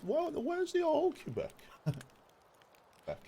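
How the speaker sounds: mock plaintive falsetto; silly high voice. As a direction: whine it high like a lost puppy. Mock pining for old Quebec.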